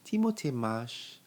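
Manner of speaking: usual speaking voice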